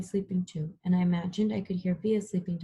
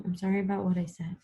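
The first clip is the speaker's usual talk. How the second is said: ordinary delivery, nothing marked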